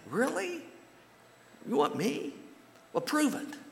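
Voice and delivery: mock the doubter's disbelief; skeptical disbelief. People doubting God's offer.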